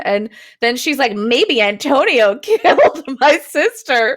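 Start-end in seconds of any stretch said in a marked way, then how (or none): 1.1-4.2 mock a silly detective's big deduction; exaggerated accusation with a laugh in it; mock accusing. Heroine's silly accusation mocked in retelling.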